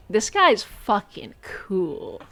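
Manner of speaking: whispered slowly, in mock awe. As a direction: breathe it out like a hushed admirer. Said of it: "It was more like".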